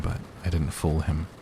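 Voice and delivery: ordinary delivery, nothing marked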